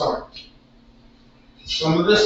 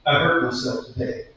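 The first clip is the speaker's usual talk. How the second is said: spoken poem; 4 semitones lower than usual. Acted. Sung line repeated as spoken poem.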